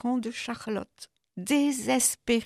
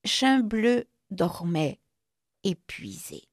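plain reading voice

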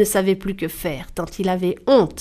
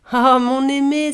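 exclaiming wife; 4 semitones higher than usual. A narrator's reading. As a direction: cry out fondly to a husband. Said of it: "s'écria Karachash". (exclamation d'une épouse)

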